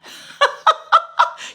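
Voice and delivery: sudden high airy laugh; high breathy laugh. Real, not acted. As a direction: laugh at your own joke. Laugh after joke about owing.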